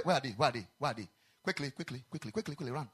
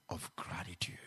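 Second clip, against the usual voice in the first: whisper; 9 semitones lower, 9 dB quieter than usual. Acted. Preacher drops voice on key phrase.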